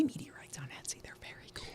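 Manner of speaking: hushed whispered aside; whispering. Real, not acted. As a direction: whisper it like a secret. Aside about buying meteorites.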